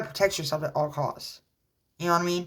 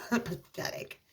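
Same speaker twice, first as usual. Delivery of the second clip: scornful whisper; 4 semitones higher, 9 dB quieter, slower than usual. Real, not acted. Contempt at the ex.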